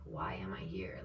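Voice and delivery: low, soft, baffled officer; imitated campus police. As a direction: lightly voice an unimpressed policeman. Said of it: "campus police was literally like".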